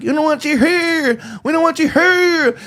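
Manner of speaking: high, drawn-out imitation of a Louisiana-Alabama accent; high mock Southern accent, last word stretched. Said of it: "they do have that accent".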